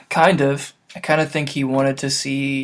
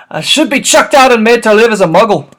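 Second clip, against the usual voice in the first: loud, higher wailing giant voice; loud remorseful gamekeeper; Hagrid voice; 8 semitones higher, 12 dB louder than usual. Acted. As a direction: wail it out like a giant in despair. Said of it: "in Hagrid voice".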